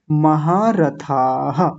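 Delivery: महारथाः slowly with a breathy ha at the end; slow teacher demo; audible visarga. Shown as a demonstration. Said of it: "विसर्ग को बोलना है".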